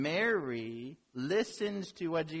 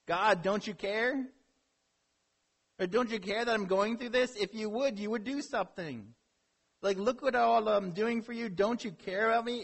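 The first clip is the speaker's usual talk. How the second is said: higher, louder, whiny plea to God; passive-aggressive complaint; 6 semitones higher, 4 dB louder than usual. Pretend. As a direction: complain to the Lord, voice pitched up and pushed. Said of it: "a passive-aggressive way of saying".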